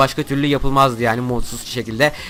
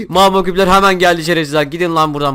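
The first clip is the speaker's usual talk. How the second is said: higher, louder exasperated curse; a real, loud, irritated yell; annoyed outburst; 4 semitones higher, 4 dB louder than usual. Real, not acted. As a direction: swear at the mobs as they spawn. Mobs swarm him.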